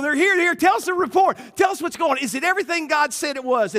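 impatient to hear the report; hammed-up eager Israelites; eager crowd. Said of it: "all the people are sitting there going".

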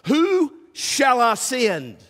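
slow, weighty question; dramatic pause. Shown as a demonstration. Preacher reading God's call, slow and dramatic. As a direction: draw it out slowly. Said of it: "I call that the dramatic pause".

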